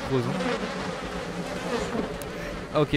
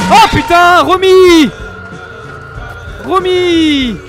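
panicked shout, very high and loud; 13 semitones higher, 12 dB louder, much slower than usual. Real, not acted. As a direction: yell her name in alarm. Cries out for lost companion.

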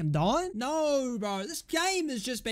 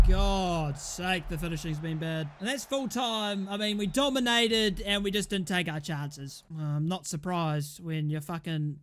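ordinary talk, nothing marked

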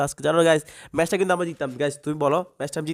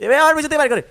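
startled cry; 11 semitones higher, 7 dB louder, faster than usual. Real, not acted. Shot from behind.

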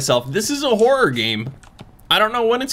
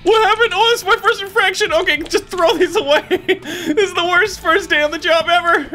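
shocked; 12 semitones higher than usual. Real, not acted. Gamer hit with an infraction, high startled cry. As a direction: yelp at the sudden infraction.

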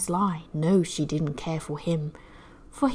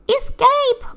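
much higher, mewing cry; meowing cat; 5 dB louder than usual. Acted. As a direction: meow the word like a cat. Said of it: "meowed the cat".

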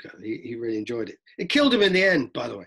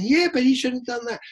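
much higher woman's voice; woman in the crowd; 12 semitones higher, 4 dB louder than usual. Retold or imitated. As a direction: raise pitch to play her. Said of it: "the woman next to you is going".

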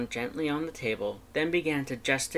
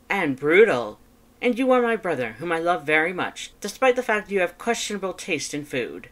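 higher, louder emphatic delivery; grandiose brother; 6 semitones higher, 7 dB louder than usual. A narrator's reading. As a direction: declare it with theatrical weight.

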